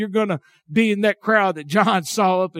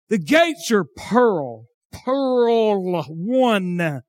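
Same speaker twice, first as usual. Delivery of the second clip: slow hillbilly drawl; thick, stretched-out hillbilly pronunciation; hillbilly accent; 3 semitones higher, much slower than usual. Real, not acted. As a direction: draw the vowels out in a Southern hillbilly accent. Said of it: "with my hillbilly accent".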